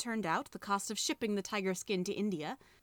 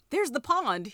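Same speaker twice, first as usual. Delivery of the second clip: higher, louder cry of discovery; excited cry; 5 semitones higher, 6 dB louder than usual. A narrator's reading. Diggory finding a trap in the garden, excited cry. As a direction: call it out on spotting it. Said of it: "he cried".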